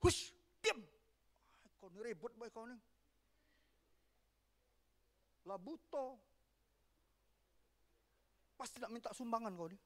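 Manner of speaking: hissed, dismissive hush that drops soft; hushing scold. Acted. Bystanders shushing the blind beggar, soft scornful hush. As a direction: shush the noisy beggar with contempt.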